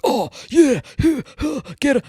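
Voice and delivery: high, excited mock cheering at the TV; mock whisper-scream. Imitating husband cheering a fight on TV, high and excited. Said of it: "He's whisper screaming".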